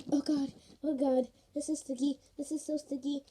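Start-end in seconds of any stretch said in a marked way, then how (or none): none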